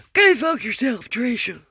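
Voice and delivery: high, loud mock yell back; imitating the husband yelling back at his wife; imitating a neighbor's yell. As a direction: shout it back like the angry husband. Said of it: "I hear 'em yelling at each other".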